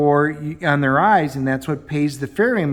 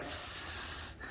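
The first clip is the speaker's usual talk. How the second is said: voiceless hissing mouse; a soft imitated rodent hiss; 9 dB quieter than usual. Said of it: "the mouse is like".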